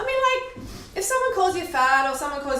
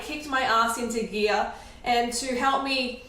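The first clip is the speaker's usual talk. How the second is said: ordinary talk, nothing marked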